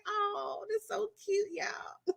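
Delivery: soft high cooing; gushing. Retold or imitated. Watching both get jealous, soft high coo. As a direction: coo it softly, delighted. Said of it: "I was like".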